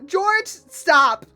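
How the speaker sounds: normal talking voice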